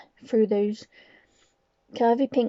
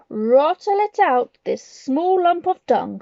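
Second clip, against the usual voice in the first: slow, louder screeching command; 6 semitones higher, 7 dB louder, slower than usual. Acted. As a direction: screech the order. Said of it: "screeched the Grand High Witch".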